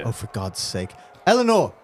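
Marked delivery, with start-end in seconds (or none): 1.3-1.7 loud, high summons by name; calling out. Acted. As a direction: call out sharply to the next person. Calls on another player to hit.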